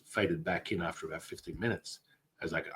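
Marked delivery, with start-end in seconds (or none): none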